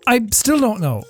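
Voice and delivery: ordinary talk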